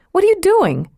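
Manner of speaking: high, sharp startled question. Acted. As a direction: blurt it out in surprise. Eve startled as he undresses.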